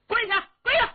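high, loud barked order; loud shouted command. A narrator's reading. Palace guards shouting at Cheng Yaojin to kneel, loud. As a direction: bellow at the prisoner to kneel. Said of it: "高声喊嚷".

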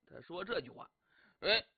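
ordinary delivery, nothing marked

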